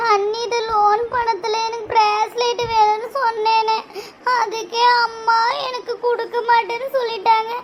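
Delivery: put-on tearful whining. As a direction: wail your complaint to big brother. Sister tearfully complains to brother.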